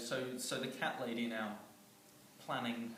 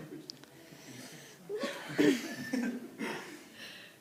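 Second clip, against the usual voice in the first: long high breathy laugh; 12 semitones higher, 4 dB louder than usual. Real, not acted.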